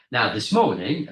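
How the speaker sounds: ordinary talk, nothing marked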